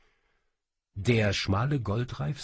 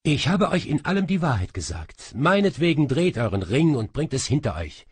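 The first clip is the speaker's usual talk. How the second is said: defiant; 6 semitones higher, 7 dB louder, faster than usual. Acted. Asmira defies the king.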